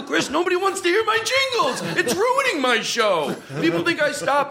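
high pitched whining protest; high whiny voice. Retold or imitated. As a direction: squeal it out, laughing. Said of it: "And I go".

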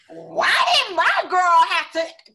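high, loud imitation of an outraged boyfriend; livid Danish boyfriend. Boyfriend protesting for his girlfriend at the store, imitated livid. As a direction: act out his indignant outburst, high and loud. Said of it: "in his Danish accent, and he was livid".

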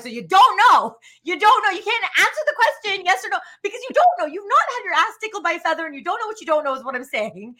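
nail the heckled man with the punchline; emphatic comic rant. Retold or imitated. Comedy bit punchline, pressing audience.

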